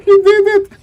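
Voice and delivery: high squealing laugh then cheer; laughing shout. Real, not acted. Laughing at the stolen item.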